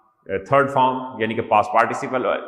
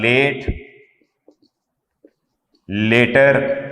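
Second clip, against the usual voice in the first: dictating; 5 dB louder, much slower than usual. Acted. Teacher dictating while writing.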